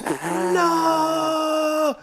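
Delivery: long, high, drawn-out NO; mock-dramatic wail of no, held long; screamed no. Film hero screaming at a church, long mock-dramatic scream. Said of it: "he's still screaming no".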